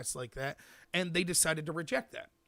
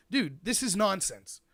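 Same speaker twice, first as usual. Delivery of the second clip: slow, louder, exasperated telling-off; blunt objection; 3 semitones higher, 6 dB louder, slower than usual. Acted. Someone telling debaters off, blunt and slow. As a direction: spell it out slowly and firmly.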